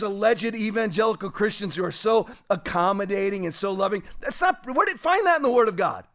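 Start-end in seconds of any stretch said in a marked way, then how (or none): none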